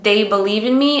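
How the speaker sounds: ordinary delivery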